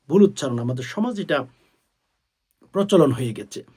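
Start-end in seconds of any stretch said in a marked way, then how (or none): none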